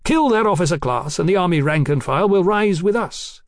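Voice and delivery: ordinary delivery, nothing marked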